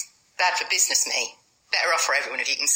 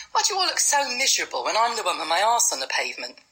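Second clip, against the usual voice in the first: ordinary delivery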